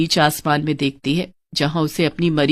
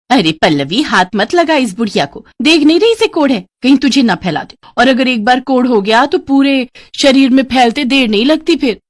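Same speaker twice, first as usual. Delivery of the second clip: stepmother's agitated warning, pitched higher; alarmed warning; 6 semitones higher, 3 dB louder than usual. Acted. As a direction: warn her off in alarm. Stepmother warns against touching leper. (घबराई हुई चेतावनी)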